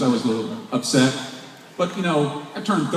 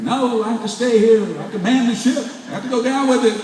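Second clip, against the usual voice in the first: mock heroic; 9 semitones higher than usual. Retold defiant refusal to leave.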